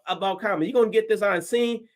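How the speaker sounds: usual speaking voice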